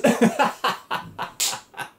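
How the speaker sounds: high burst of laughter; big laugh. Real, not acted. Teller laughing at his own story, high and hearty. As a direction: crack up at the memory.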